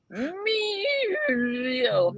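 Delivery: high, strained dying toy meow. Retold or imitated. Old Fisher-Price toy on its last battery pull, high strained meow. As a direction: whine it like a toy with a weak battery. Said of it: "and it's like".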